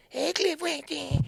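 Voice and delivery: high, drawn-out fan squeal; mock adoring greeting, way up high; imitated fan. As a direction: put on a very high, slow fan voice. Imitates the fan who grabbed him.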